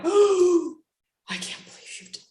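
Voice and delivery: high gasp, then a breathy whisper; shocked, whispered disbelief. Shown as a demonstration. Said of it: "you're like, gasps".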